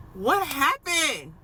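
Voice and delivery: high, loud, drawn-out bewilderment; baffled outburst. Real, not acted. Mother who cannot explain how baby moved, utterly baffled. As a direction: stretch it out slowly in high-pitched disbelief.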